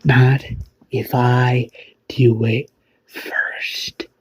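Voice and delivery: slow, unvoiced menacing whisper; slow whispered villain vow; threatening whisper. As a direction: breathe the threat slowly.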